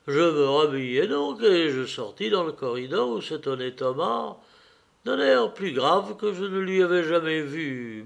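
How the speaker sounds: plain reading voice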